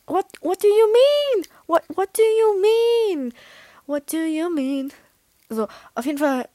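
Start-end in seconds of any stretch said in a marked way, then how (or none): 0.1-3.3 high, loud, drawn-out incredulous wail; slow, over-the-top exclamation of bafflement; exaggerated bafflement. Mock outrage at the reply. 3.9-4.9 subdued bafflement. Acted. Last repeat, dying down.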